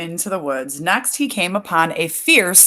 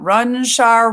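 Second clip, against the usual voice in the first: higher, louder storybook call; crying out; 4 semitones higher, 5 dB louder than usual. A narrator's reading. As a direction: sing out the taunt to the chasers. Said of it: "crying out".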